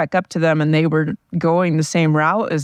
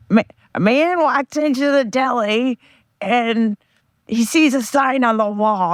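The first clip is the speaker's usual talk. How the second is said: higher, mock friend voice telling a joke; imitating my friend; 5 semitones higher than usual. To her viewers, retelling a friend's joke in his mocked, higher voice. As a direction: put on a friend's joking voice, pitched up. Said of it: "I'm going to try to impersonate my friend telling the joke".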